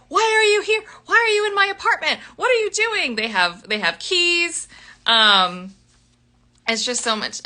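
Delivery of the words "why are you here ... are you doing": put-on high-pitched, fast, alarmed girl voice; startled shriek. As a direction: squeal it out as the startled heroine. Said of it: "She's like".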